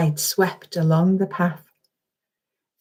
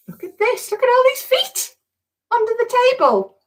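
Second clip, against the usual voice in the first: much higher, bright look-at-this voice; excited discovery. Real, not acted. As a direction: point it out with delight. Excited picture book pointing.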